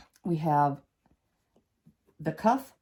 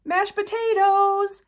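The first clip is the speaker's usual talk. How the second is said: loud, high, held-out ending; playful reveal; 8 dB louder, slower than usual. Pretend. Quilter revealing auntie's favorite food, playfully loud drawn-out cheer. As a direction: trumpet it like a big prize, stretching the last syllable.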